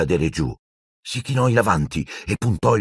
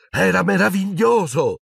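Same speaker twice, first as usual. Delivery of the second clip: much higher, joyful lift; thrilled exclamation; 11 semitones higher than usual. A narrator's reading. Narrator voices Harry's delight at flying. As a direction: let the joy burst out.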